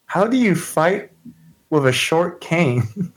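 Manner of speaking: high, wide-eyed character voice; wide-eyed Shauna. Acted. Shauna asking about the short cane, wide-eyed. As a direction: ask it in a high, wondering voice. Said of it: "She's kinda a little wide-eyed".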